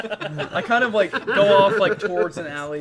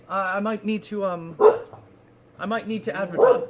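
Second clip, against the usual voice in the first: usual speaking voice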